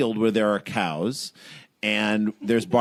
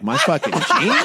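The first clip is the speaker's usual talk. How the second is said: louder, higher indignant question; retold pissed-off outrage; 6 semitones higher, 5 dB louder than usual. Storyteller finding his favorite jeans ripped, pissed-off outrage. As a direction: play up the outrage, half laughing. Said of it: "this is the moment at which I get pissed".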